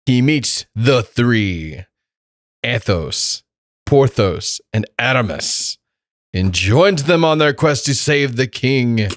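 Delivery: slow, grand movie-trailer read; dramatic blurb reading. Acted. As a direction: announce it like a film trailer. Reading the IMDB blurb aloud.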